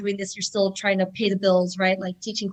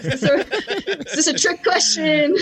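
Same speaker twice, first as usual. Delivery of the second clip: higher, louder, wary mock suspicion; suspicious disbelief; 7 semitones higher, 5 dB louder, slower than usual. Husband's question seems a trap, mock suspicious. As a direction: ask it as if sensing a trap. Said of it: "I'm like".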